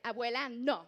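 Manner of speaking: loud, firm scolding; mock yelling. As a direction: scold grandma at full volume. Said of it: "I know, I yell at them".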